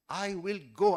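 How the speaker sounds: "I will go" said loud and insistent; emphatic stress. Shown as a demonstration. Trainer repeating the stress example, "I will go" loud and emphatic. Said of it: "giving a stress to three different words".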